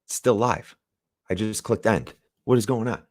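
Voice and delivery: normal talking voice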